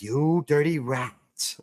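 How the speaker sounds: put-on slow, drawn-out tough-guy snarl of an old movie gangster; James Cagney impression. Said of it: "James Cagney is like".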